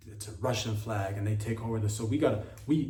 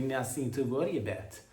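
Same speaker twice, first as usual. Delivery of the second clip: put-on Russian accent. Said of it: "He's like".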